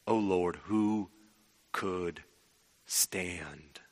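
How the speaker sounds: low, halting, word by word; fading into awe, with long pauses; hushed solemn reading. Acted. Solemn re-reading of verse three.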